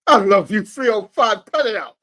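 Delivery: high, loud, excited call to the fans; a joking shout-out to Miami fans. Real, not acted. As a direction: holler it out to the crowd.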